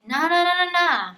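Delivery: teasing no no. Retold or imitated. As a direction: wave away her protest. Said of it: "And he's like".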